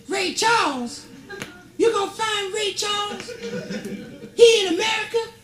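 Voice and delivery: high, shrill-free squeal of a frantic queen; panicked queen. Acted. Panicked Queen in stand-up bit.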